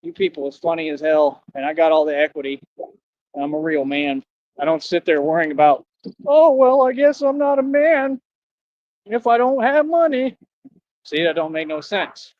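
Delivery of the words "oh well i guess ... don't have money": mocking quote. Mocking a man who frets.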